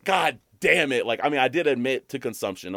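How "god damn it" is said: exasperated curse. Retold or imitated. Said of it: "I'm like".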